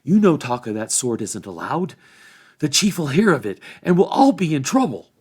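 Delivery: high, loud scolding warning; worried hobbits. A narrator's reading. Hobbits warning Hob the chief will hear, high urgent scolding. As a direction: warn him off urgently.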